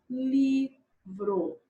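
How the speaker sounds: "livro" drawn out in two pieces; syllable by syllable. Shown as a demonstration. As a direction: separate it slowly: li... vro. Teacher splits word into syllables. ("livro" devagar, separado em sílabas)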